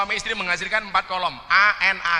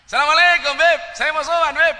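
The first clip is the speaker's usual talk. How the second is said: high, loud Madurese greeting; Madurese visitor greeting; 9 semitones higher, 8 dB louder than usual. Retold or imitated. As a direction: greet the cleric eagerly in a Madurese accent. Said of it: "orang Madura sowan".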